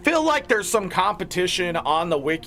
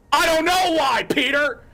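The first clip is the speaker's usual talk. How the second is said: pitched up, louder, fed-up outburst at Peter; exasperated outburst; 7 semitones higher, 3 dB louder than usual. Real, not acted. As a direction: throw up your hands at him. Exasperated rant at commenter.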